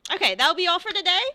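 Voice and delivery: high, loud, quick dismissal; calling out. Acted. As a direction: call out to the whole class. Master calls out to his students.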